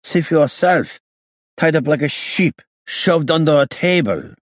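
put-on high-pitched accented whine of complaint; indignant gangster. A narrator's reading. Freed gangster complaining to the inspector, high and indignant.

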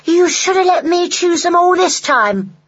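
put-on high, loud child's protest; complaining child. A narrator's reading. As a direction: protest like a put-out little kid. Child Roly complains to Henny.

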